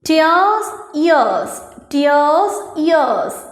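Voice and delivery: ടിയേഴ്സ് rising, ഇയേഴ്സ് falling, each drawn out; demonstrated rhyme. Shown as a demonstration. Teacher showing pupils two words sound alike, demonstrated rhyme. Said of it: "അതും ഏകദേശം ഒരുപോലെയാണ്".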